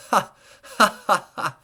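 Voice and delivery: high, loud gleeful cackle; triumphant madman's cackle; mad laugh. A narrator's reading. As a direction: laugh like a madman proud of his trick. Mad narrator laughs at cleverness.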